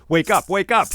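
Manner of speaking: loud, high shout to wake him. Acted. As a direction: yell to rouse a sleeper. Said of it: "Enkidu shouted again".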